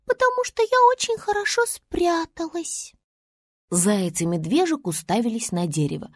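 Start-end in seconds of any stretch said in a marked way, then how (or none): 0.1-2.9 very high small voice; high-pitched little creature; hidden star. Acted. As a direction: answer from hiding in a tiny high voice. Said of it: "донёсся голос дерева".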